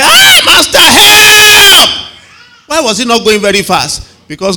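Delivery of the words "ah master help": very high, loud, desperate shout; Peter's cry for help. Retold or imitated. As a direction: shriek it like a man going under the water. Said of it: "And he said".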